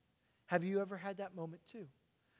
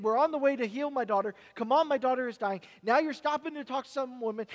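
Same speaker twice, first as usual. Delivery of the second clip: loud, pushy, higher pleading to hurry; a father's frantic let's go; impatient urging; 5 semitones higher, 9 dB louder than usual. Acted. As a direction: hurry him along like a desperate father.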